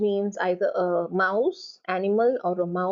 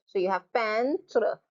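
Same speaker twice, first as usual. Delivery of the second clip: ordinary talk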